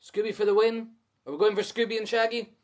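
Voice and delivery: plain reading voice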